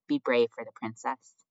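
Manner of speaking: ordinary delivery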